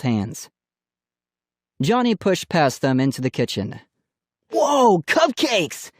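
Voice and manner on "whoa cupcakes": high, thrilled whoop at seeing cupcakes; excited cry. A narrator's reading. Boy spotting cupcakes in the kitchen, excited cry. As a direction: let the delight burst out. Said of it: "he cried".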